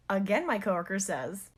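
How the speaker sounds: ordinary talk, nothing marked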